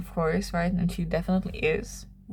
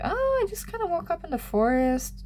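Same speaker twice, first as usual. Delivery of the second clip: innocent high voice; 11 semitones higher than usual. Retold or imitated. She lies about where she was.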